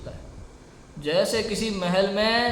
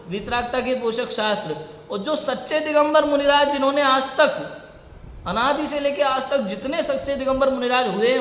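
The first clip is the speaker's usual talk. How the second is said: raised, insistent sermon voice; impassioned preaching; 4 semitones higher than usual. Acted. Preacher defending scripture to his audience, impassioned. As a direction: drive each point home to the hall.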